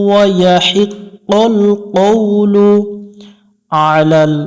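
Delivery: slow melodic tajweed chant; Quran recitation. Acted. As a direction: draw out every vowel in recitation. Recites the phrase. (ধীর সুরেলা কুরআন তিলাওয়াত)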